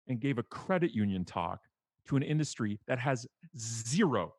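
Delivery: ordinary talk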